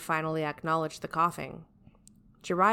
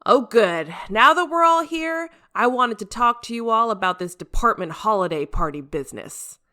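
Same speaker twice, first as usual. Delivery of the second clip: booming, much higher and louder boss voice; loud captain Jiraiya; 5 semitones higher, 9 dB louder than usual. Acted. Brash captain addressing his team, loud and high. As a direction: announce it like a brash captain taking charge.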